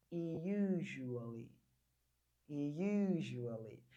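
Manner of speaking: usually stretched out very slowly; slow model pronunciation. Shown as a demonstration. Teacher reads the word from the phonology, very slow. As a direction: drag out usually syllable by syllable. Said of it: "lê da forma que está aqui".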